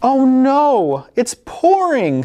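high, slowed oh no; mock dismay. Mom reacting to the pouring rain, mock alarm. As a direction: play up the shock of the rain.

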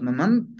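mamam said "ma-MAN"; dropped ending. Shown as a demonstration. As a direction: lose the whole tsik ending in mamam. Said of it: "se pierde la".

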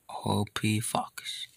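slow whisper of grief; hushed mourning. Acted. Whispering a farewell to the fox, slow and hushed. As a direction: whisper it like a eulogy.

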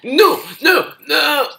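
very high, loud cry of dismay; anguished yell. Real, not acted. Gamer losing the match, anguished yell. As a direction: scream out the loss.

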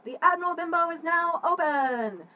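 calls it out high and loud; loud announcing call. Shown as a demonstration. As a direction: call it out to the whole inn. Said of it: "calls out".

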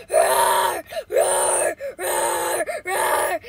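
loud, rough repeated roars; animal roar. Pretend. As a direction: roar like an attacking beast. Roaring as an animal.

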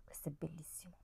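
breathy hushed admiration; whispered as if sharing a secret. Real, not acted. Vlogger to viewers about a lipstick, whispered praise.

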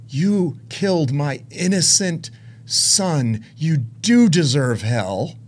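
very slow, weighted accusation; God's solemn rebuke. Retold or imitated. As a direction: pronounce each word as divine judgment. Voicing the Lord's answer.

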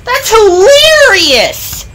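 high, loud gleeful squeal; amused exclamation. A narrator's reading. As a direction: exclaim it with high-pitched glee. Freight car delighted by runaway.

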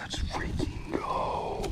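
slow drawn-out triumphant whisper; excited whisper. Real, not acted. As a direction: savour the win under your breath. Fish landed.

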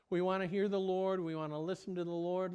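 ordinary talk, nothing marked

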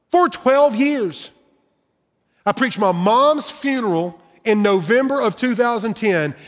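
rising pulpit intensity; preaching. Acted. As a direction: preach it to the room with conviction. Preaching his own losses.

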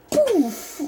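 ordinary delivery

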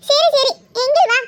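slow, laughing through the words; a light laugh carries each word. Real, not acted. Wife laughing to husband, happy to eat together, laughing.

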